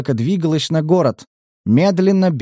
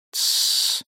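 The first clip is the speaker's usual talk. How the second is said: shushing; 6 dB quieter than usual. A narrator's reading. Lieutenant hushes secret talk.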